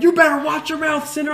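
high, loud hammed-up preacher; preacher's shouted warning, hammed up; mock preacher shout. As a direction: yell doom like a caricatured preacher. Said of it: "And he's like".

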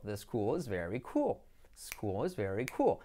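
low, slow "school" and "cool" ending in -ol; pronunciación correcta. Shown as a demonstration. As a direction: demonstrate slowly, dark l in "school" and "cool". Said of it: "Como school". ("school" y "cool" graves y lentos, «scúol», «cúol»)

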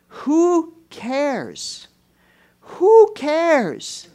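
high, drawn-out preacher's cry; emphatic dismissal. Real, not acted. Preacher dismissing Naaman's expectations, high emphatic cry.

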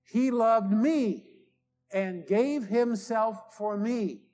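very slow, stressing "me"; slow call-and-response. Shown as a demonstration. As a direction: lead the congregation through it, pausing on "me". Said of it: "Will you say that with me?".

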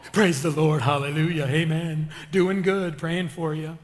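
low, loud put-on pious voice; fake church talk. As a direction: parody a phony saint intoning church phrases. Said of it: "'Cause don't nobody talk like that".

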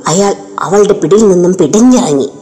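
ordinary delivery

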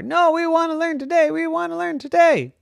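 put-on high, loud whiny kid insistence; insistent kids. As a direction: push back like stubborn kids.

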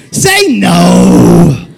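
loud call to the crowd; preacher rallying the crowd; shouted cue. Real, not acted. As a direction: shout it out to the room. Preacher calling to congregation.

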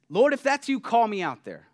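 loud, high call across the water. Acted. Peter calls to Jesus from the boat, loud challenge. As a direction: call out boldly to someone far off.

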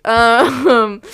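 bursts into a loud laugh. Real, not acted. Laughing at own delusion.

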